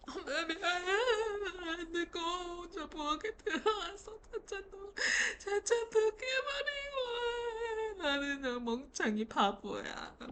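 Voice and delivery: high, slow, whiny crying apology; fake sobbing. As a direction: wail pitifully to win mom over. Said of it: "불쌍한 척을 해야 돼". (엄마에게 불쌍하게 우는 척하며 사과)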